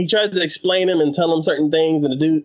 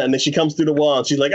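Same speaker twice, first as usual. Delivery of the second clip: normal talking voice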